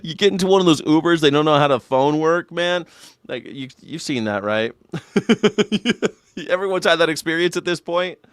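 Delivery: usual speaking voice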